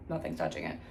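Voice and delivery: ordinary talk, nothing marked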